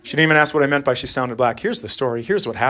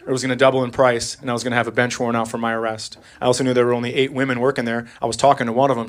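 usual speaking voice

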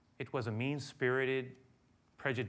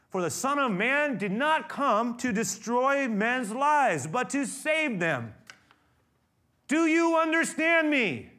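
loud, higher, slow impassioned plea; an impassioned rebuke, much louder and slower; passionate rebuke; 11 semitones higher, 11 dB louder, slower than usual. Acted. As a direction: drive it home with fervent force. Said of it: "That was passionately said".

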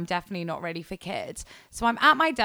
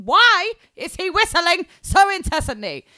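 high, loud, irritated question; exasperated rant; 10 semitones higher, 7 dB louder than usual. Retold or imitated. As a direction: vent the annoyance, pitched up. Ranting about whistling.

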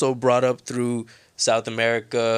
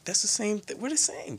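hushed realization; 13 semitones higher, 4 dB quieter than usual. Retold or imitated. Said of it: "I was like".

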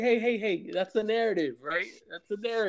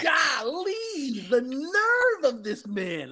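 higher, slow, scandalized voice; mock outrage; 7 semitones higher, much slower than usual. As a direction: clutch your pearls, drawn out.

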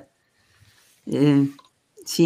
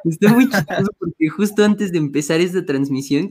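usual speaking voice

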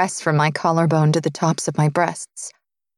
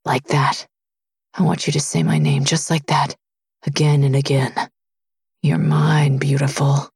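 low, partly whispered possessive murmur; low possessive lover; 5 semitones lower than usual. Acted. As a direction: murmur it low, claiming her. Possessive lover voiced by narrator.

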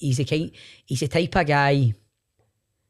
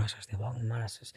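much softer, under the breath; quiet despair; 14 dB quieter than usual. Retold or imitated. Co-host texting him during a dead interview, hushed and softer. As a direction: mutter it like a panicked text. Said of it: "texting each other going".

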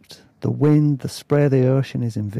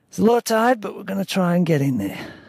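high put-on accent, easing down at the end; Dave; 7 semitones higher than usual. A narrator's reading. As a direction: start high in a character accent, then drop. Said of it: "I can't do his accent".

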